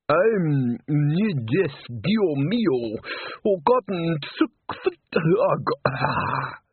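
drawn-out, rising groaned words; high, slow swoon into a sigh; overcome interpreter. A narrator's reading. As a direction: stretch the words out as if overwhelmed.

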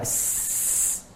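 s as a bare voiceless hiss; whispered s. Shown as a demonstration. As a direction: hiss the silent s to find its place. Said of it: "Anda matikan, sukunkan".

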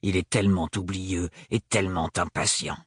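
low, stern character voice; understated, severe delivery; hard voice. A narrator's reading. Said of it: "d'une voix dure".

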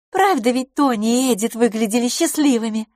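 high, wistfully sighed question; sighing. A narrator's reading. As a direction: ask with a light sigh. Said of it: "вздохнула мисс Лафос". (со вздохом, высоким голосом)

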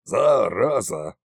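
slow, low sulky Зараза; glum curse. Acted. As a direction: sigh it out in self-pity. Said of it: "загрустил царь-батюшка".

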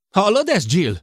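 loud, high, indignant appeal; high angry shout. A narrator's reading. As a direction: yell to another person in outrage. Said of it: "kiabált tovább Sid".

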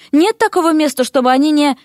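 high, exasperated protest cut off; indignant. A narrator's reading. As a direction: protest until interrupted. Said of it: "возмутился Дерек". (возмущённо, на повышенных тонах)

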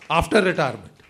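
normal talking voice

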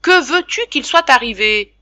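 loud, high and gruff. Acted. Father brushing off the worried mother, gruff loud tone. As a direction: grumble it off loudly. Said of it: "d'un ton bourru".

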